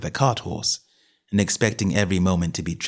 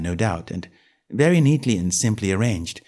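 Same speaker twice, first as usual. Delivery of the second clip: ordinary delivery, nothing marked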